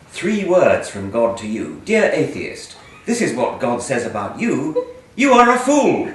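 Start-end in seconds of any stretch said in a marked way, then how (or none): 1.9-6.1 loud, mock-indignant reading of hate mail aloud. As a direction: belt out the angry letter like a hammed-up zealot, louder and higher. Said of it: "read some of your hate mail".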